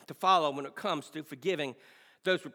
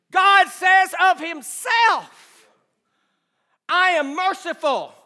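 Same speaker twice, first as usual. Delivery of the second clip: slow, loud, booming proclamation; preaching at full force; 12 semitones higher, 15 dB louder, slower than usual. Acted. Pastor proclaiming God's mercy to the church. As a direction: declare it like God himself.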